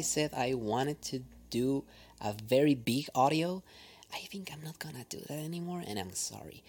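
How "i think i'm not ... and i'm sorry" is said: fast unvoiced whispered apology; whispering. Real, not acted. Confessing she cancels the audio.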